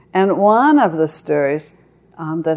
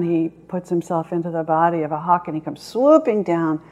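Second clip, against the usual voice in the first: ordinary talk, nothing marked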